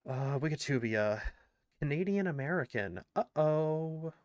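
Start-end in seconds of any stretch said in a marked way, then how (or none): none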